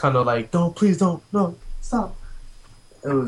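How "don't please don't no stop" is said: lightly acted begging, pitched up; higher, retold plea; mock pleading. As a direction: plead with them to stop, half in play. Acts out pleading with game character.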